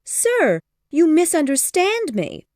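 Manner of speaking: worried. Acted. Mother to Mr Collins, high worried protest. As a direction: rush to correct him, anxious.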